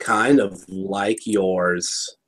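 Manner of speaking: slow, low mock pony voice; mimicked pony reply. Cohost echoing the pony lady's line, mimicking slowly.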